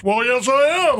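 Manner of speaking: booming, theatrical self-introduction; Gene Deckerhoff. Retold or imitated. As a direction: imitate a big-voiced broadcaster owning up. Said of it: "you know how Gene is".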